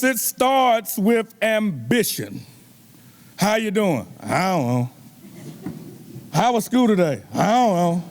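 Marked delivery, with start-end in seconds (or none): 4.2-4.9 low, mumbled, barely there; grunted teenage reply; sullen teen mumble. Retold or imitated. Kid mumbling answers to parent. 7.3-8.0 low, mumbled, barely there; grunted teenage reply; sullen teen mumble. Retold or imitated. Kid mumbling answers to parent.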